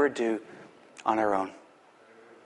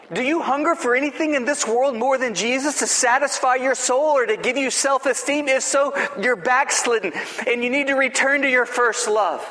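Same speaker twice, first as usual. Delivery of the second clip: fervent preaching; 8 semitones higher than usual. Acted. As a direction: call them back with full fervor. Preaching at full force.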